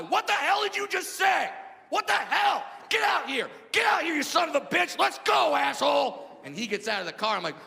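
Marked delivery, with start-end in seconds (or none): 0.0-6.3 angry yelling. Retold or imitated. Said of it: "I start yelling at this guy".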